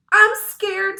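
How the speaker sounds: high, loud frightened wail; scared. A narrator's reading. Timid Emily seeing the snake, a high frightened cry. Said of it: "who was scared of everything".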